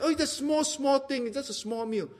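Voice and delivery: high, softening host's demurral; mock-humble little disclaimer; modest downplaying. Host playing down a big meal.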